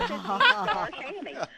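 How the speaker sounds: reading the line through a burst of laughter; laughing while reading. Real, not acted. Caller reads promo, laughs.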